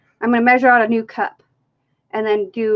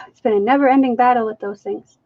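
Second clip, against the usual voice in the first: usual speaking voice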